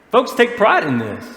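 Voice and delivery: ordinary talk, nothing marked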